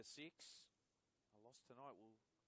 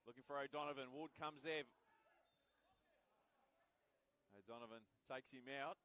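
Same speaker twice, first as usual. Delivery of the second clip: live play-by-play; 3 semitones higher, 10 dB louder than usual. Acted. Live call of a cross.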